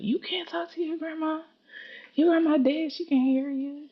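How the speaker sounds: high-pitched imitation of an incredulous mother; mock scolding mom. Retold mom scolds about dead grandma.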